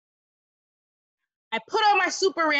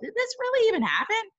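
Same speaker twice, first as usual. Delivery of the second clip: surprised; 11 semitones higher, faster than usual. Real, not acted. Reader wondering aloud.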